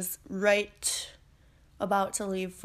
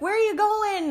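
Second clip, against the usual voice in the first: chatty cashier in a much higher voice; nosy cashier; 12 semitones higher, 4 dB louder than usual. Retold or imitated. Cashier quizzing the narrator at the checkout, much higher voice. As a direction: pitch up high and pry like a talkative cashier. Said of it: "She's like".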